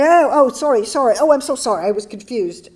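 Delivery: high, loud flustered sorry in a bad British accent; flustered apology. Young woman apologizing to the speaker, high and flustered. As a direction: gush apologies, embarrassed. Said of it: "and she said".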